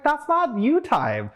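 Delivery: drawn-out high playful scold; high, slow mock scold. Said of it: "She's like".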